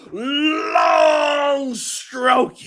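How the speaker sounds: very slow, drawn-out crude punchline; slow comic drawl with a yelp; dragged out. Pretend. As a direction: stretch every word for the joke.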